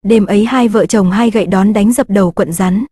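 usual speaking voice

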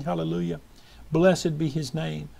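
ordinary talk, nothing marked

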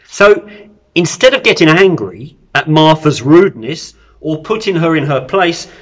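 ordinary talk

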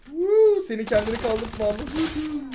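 high, loud triumphant whoop; excited whoop. Real, not acted. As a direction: let out a big victory whoop. Scoring a kill in game. (yüksek ve yüksek sesli zafer çığlığı)

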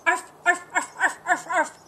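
high, loud playful barks; barking arfs. Acted. The dogs barking at the birds, loud and playful. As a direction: bark arf like a cheerful dog. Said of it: "said the dogs".

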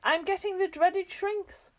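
high, slow horrified wail; shrinking husband wailing in horror; panicked wail. A narrator's reading. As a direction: stretch out the dread.

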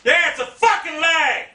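high-pitched, exasperated yell; angry complaint. Real, not acted. Gamer blaming lag, exasperated yelling. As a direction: blame the lag in outrage.

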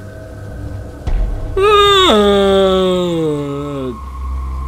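long, high moan gliding slowly down; loud whale call, falling away; whale imitation. Shown as a demonstration. Showing off a great imitation, whale call. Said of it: "That was an imitation of a whale".

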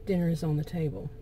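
soft, calm statement of fact; matter-of-fact. Acted. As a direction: state it softly, without any attitude. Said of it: "just a matter of fact".

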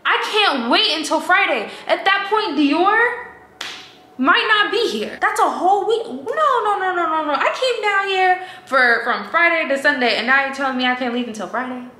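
high-pitched, wailing disbelief; hammed-up high, upset pleading; acted panicked protest. Acting out distressed protest to agent.

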